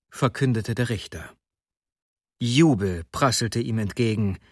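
plain reading voice

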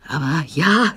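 mildly flustered agreement, rising at the end; confused. A narrator's reading. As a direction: sound slightly thrown while agreeing. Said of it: "sagte der Doktor verwirrt". (leicht verwirrt)

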